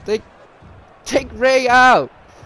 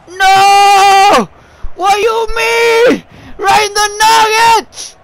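loud high outraged yell; shouting; 12 semitones higher, 8 dB louder, slower than usual. Real, not acted. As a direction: yell it out in shock. Shocked shouting at the game.

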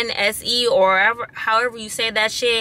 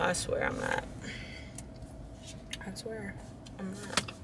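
under her breath; 16 dB quieter than usual. Real, not acted. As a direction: swear it quietly, almost to yourself. Muttering frustration to herself.

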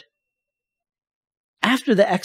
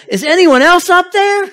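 very high, loud panicked shriek; terrified scream; 9 dB louder than usual. Acted. Terrified skier screams up, refusing to let go, high panicked shriek. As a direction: scream it in comic terror. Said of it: "with a terrified scream".

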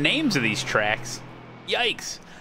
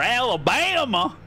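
higher, hammed-up Southern twang; Southern accent; 8 semitones higher than usual. Shown as a demonstration. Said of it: "put a southern accent on it".

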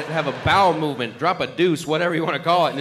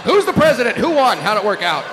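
excited questions; 5 semitones higher, 7 dB louder than usual. Acted. Cat coming out of hiding, comic voice.

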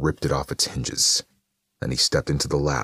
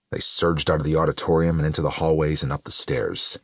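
usual speaking voice

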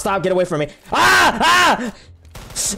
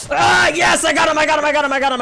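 excited cheer; 10 semitones higher, 3 dB louder, faster than usual. Real, not acted. Wins the round.

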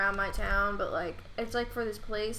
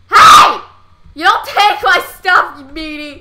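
loud high yell that cools by the end; angry shout; 6 semitones higher, 17 dB louder than usual. Real, not acted. Yelling at a player who took his stuff, angry shout. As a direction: shout them down for taking your stuff. Yelling at game character stealing.

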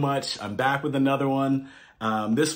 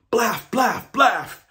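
high, clipped barks; dog barking; 9 semitones higher, slower than usual. A narrator's reading. Reading to kids, barking like a Dutch dog. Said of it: "Dogs in Holland bark".

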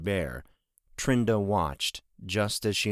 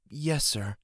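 downcast; 6 semitones higher than usual. Acted. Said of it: "looking downcast".

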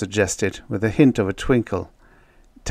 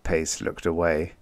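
usual speaking voice

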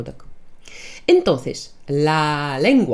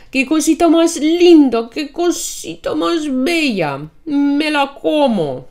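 put-on high, loud cooing baby talk; kindergarten teacher; 9 semitones higher, 6 dB louder than usual. Shown as a demonstration. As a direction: gush over a cute pet. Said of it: "сюсюкаясь". (сюсюкающий голос, как с малышом)

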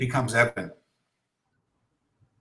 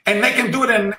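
ordinary delivery

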